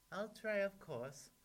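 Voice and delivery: put-on high, loud obliging storybook girl. A narrator's reading.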